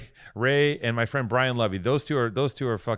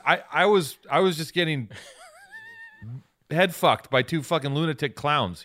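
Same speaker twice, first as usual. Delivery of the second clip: ordinary talk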